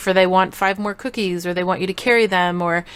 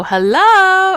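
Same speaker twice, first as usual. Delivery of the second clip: loud, high, drawn-out hello; playful holler to grab kids' attention; kinda loud; 12 semitones higher, 8 dB louder than usual. Shown as a demonstration. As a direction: sing out a long loud greeting. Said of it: "I'll say something kinda loud".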